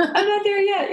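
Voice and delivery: laughing. Real, not acted. Guest admitting she doesn't know, laughing through the words. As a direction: laugh it off, unsure of yourself. Laughing at her own uncertainty.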